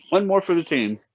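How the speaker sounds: talking through a mouthful of chips; mouth full. Real, not acted. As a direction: say it while chewing a mouthful. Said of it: "talk with my mouth full".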